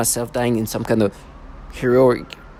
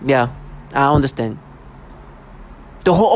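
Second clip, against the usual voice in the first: ordinary delivery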